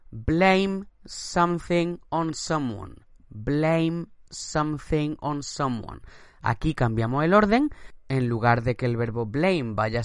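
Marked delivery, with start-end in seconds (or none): 0.1-3.0 slow, higher model phrase; slow teacher model; slow structure read. Shown as a demonstration. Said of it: "teacher models structure". 3.3-6.0 slow model phrase again; slow teacher repeat. Shown as a demonstration. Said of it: "teacher repeats structure".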